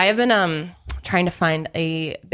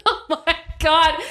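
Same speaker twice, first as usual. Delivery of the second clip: laughing through the words; giggly, slowed laugh; 8 semitones higher than usual. Real, not acted. Laughing during failed impressions.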